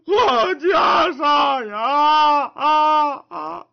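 put-on high, loud wailing old monk; wailing cry. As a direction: burst into howling sobs over the robe. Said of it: "看着看着是嚎啕大哭啊".

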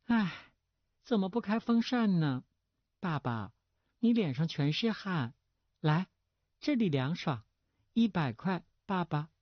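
high, pampered-daughter call; coy, artless girl. A narrator's reading. Daughter fussing over sweaty father for money, coy and artless. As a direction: coax father in a girlish high voice. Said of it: "娇憨的又叫道".

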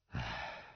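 low, soft sigh; weary sigh. A narrator's reading. Father hearing his daughter's excuse, weary low sigh.

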